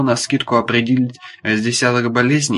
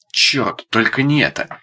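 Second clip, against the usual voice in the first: plain reading voice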